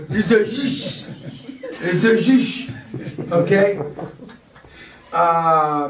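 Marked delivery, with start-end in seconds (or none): 0.1-1.6 put-on slow, sultry French accent with a laugh; drawn-out seductive French accent; sexy French accent. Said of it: "it sounds sexy". 1.8-2.7 high, laughing mock French accent; hammed-up French accent. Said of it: "it sounds sexy".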